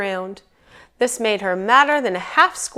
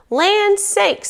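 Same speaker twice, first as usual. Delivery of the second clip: high-pitched exclaiming mama bear; 12 semitones higher, 4 dB louder than usual. A narrator's reading. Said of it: "said the mama bear".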